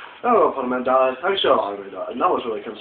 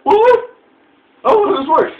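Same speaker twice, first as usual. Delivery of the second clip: very high, louder startled what; high startled exclamation; squeaky surprise; 7 dB louder, much slower than usual. Retold or imitated. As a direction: jump up in pitch, startled. Retold sudden surprise.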